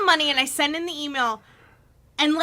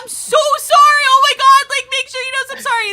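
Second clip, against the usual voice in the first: high, loud, pleading apology, faster at the end; frantic apology; 10 semitones higher, 9 dB louder, faster than usual. Retold or imitated. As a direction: beg forgiveness in a frenzy. Frantic apology email retold.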